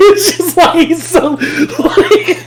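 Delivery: real high-pitched laughter breaking up the words; laughing hard. Real, not acted. Fan describing single-minded hero to friends, helplessly laughing. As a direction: crack up while trying to explain. Cracks up at the character.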